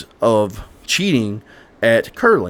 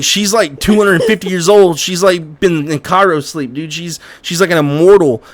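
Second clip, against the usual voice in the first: higher, louder, worked-up; blurting it out in excitement; excited; 6 semitones higher, 5 dB louder than usual. Retold or imitated. Fallout character freaking out.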